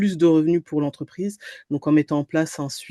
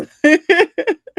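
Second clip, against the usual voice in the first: high loud burst of laughter; bright laugh; 13 semitones higher, 9 dB louder than usual. Real, not acted. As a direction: laugh out with delight. Laughs while smiling.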